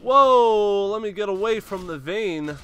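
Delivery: loud, high alarm easing down; drawn-out high yelp settling into speech; startled whoa. Real, not acted. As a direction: cry out in surprise, then ease off while backing away. Startled while fleeing the Vayne.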